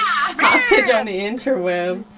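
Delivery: laughing through the words. Real, not acted. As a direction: crack up while repeating it. Repeats friend's joke, cracking up.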